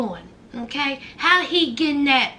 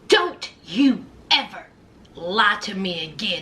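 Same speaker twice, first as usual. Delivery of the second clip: mock stern, lower voice with pauses; stern warning; 9 semitones lower than usual. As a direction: lay down the law, word by word. Voices Lindsay's warning, lower and deliberate.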